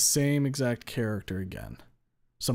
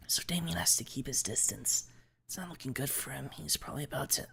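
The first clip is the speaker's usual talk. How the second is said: softer, higher airy voice; quiet, higher girl analysing a fight; Olivia explaining the game; 4 semitones higher, 6 dB quieter than usual. Acted. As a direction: keep it soft and high, absorbed in the match.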